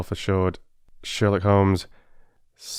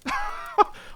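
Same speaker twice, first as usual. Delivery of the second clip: wheezy laugh. Acted. Holmes laughs at himself.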